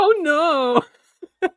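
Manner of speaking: high falsetto yelp; high loud imitation. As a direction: squeal it in falsetto. Imitating the clip's line.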